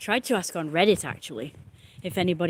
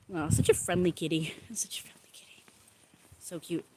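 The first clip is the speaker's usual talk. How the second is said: breathless unvoiced whisper to a cat; 5 dB quieter than usual. Real, not acted. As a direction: whisper fondly so as not to scare it.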